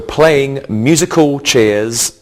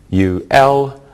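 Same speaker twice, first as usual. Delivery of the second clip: ordinary talk, nothing marked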